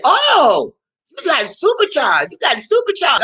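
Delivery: high, thrilled mock Puerto Rican mechanic; excited mechanic. The mechanic spots the supercharger, high and thrilled. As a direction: gush over the supercharger. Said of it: "And he said".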